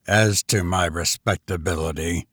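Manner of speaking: low, dry and cold. A narrator's reading. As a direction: speak it dry and curt, low. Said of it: "said the stranger dryly".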